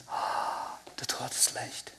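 breathy whisper of a remembered voice. Retold or imitated. Recalling the quiet word he heard, whispered. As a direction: let it fall to a bare whisper.

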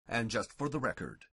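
ordinary talk

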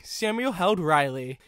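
ordinary delivery, nothing marked

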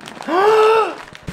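high startled gasp; surprised gasp. Acted. Mock surprise at gift.

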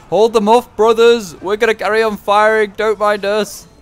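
high, loud mock soldier yelling to his brothers; panicked archer shout. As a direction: shout in a hammed-up frenzy. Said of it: "They're like".

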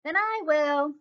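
a hint of a resolute storybook hen; little red hen. A narrator's reading. Hen volunteers for the work herself, lightly voiced character.

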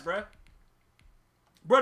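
ordinary talk

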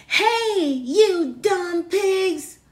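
loud, high, slow yell at the pigs. Acted. Megan yelling at the pigs in the pen, loud and slow. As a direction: shout it out, dragging each word. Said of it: "Then Megan yelled".

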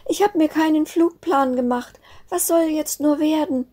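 very high, tearful little snowflake; despairing sobbing. A narrator's reading. Snowflake confesses she made no flight plan, despairing. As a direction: whine it out in a small, desperate voice. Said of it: "Verzweifelt hielt sie".

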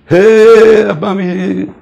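very high, loud joyful shout; excited cheer. Retold or imitated. Soldiers cheering [name]'s nickname, very high excited shout. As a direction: cheer his name to the sky. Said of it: "running around excited".

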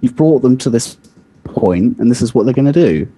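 ordinary delivery

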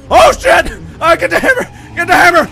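loud high frightened yelling; scared horror game streamer. Real, not acted. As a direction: panic while being chased. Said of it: "scared horror game streamer".

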